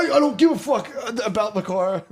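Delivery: high, half-laughed outburst; giggly mock indignation; laughing exclamation. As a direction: blurt it out high through a laugh. Laughing retold reaction.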